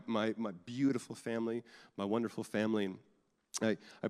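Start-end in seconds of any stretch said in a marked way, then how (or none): none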